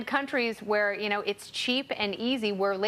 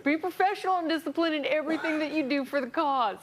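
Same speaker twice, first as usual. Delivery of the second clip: mock German accent, pitched higher, barking orders; Nazi accent; 5 semitones higher than usual. Host reading a Nazi tweet in mock German accent. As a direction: play a stern German Nazi officer. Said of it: "that's my Nazi accent".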